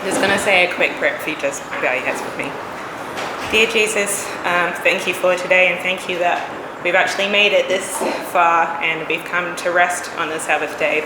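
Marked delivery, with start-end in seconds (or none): none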